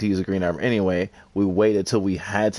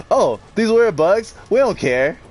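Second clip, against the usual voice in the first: dismissive mock voice; 9 semitones higher, 4 dB louder than usual. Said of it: "It was like".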